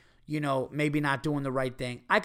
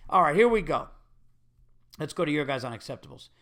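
normal talking voice

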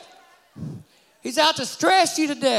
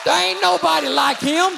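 full-force preaching; 5 semitones higher than usual. Acted. Preacher rousing the congregation to stand and praise, shouting. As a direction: stir the church up to its feet.

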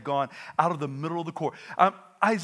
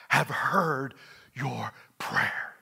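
slow, breathy whispered reassurance; hushed whisper; 5 semitones lower, much slower than usual. Acted. Preacher whispering God's answer to Hezekiah's prayer. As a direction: whisper it slowly like God answering.

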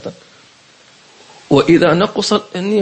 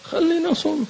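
casual worshipper; 14 semitones higher, 5 dB quieter than usual. Retold or imitated. Voicing a worshipper's casual thought.

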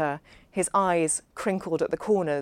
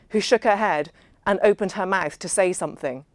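usual speaking voice

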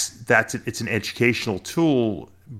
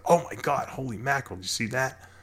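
higher, softer awestruck reaction; mock amazement; 5 semitones higher, 5 dB quieter than usual. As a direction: act out a hushed "wow" at someone's post. Acting reaction to new snake post.

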